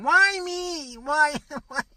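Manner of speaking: hammed-up woe-is-me wail; mock whining. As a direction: whine it like a sulking victim. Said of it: "Instead of saying".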